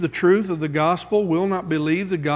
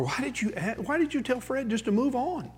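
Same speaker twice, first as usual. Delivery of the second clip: much softer, higher private question; hushed rebuke; 6 semitones higher, 9 dB quieter than usual. Retold or imitated. Preacher questioning the deacon after the visit, hushed. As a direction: ask quietly in the car, puzzled. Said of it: "After we got in the car".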